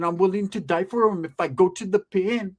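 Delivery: normal talking voice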